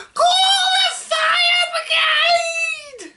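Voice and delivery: a panicked grandmother screaming for help; old woman shouting. Acted. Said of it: "she shouted".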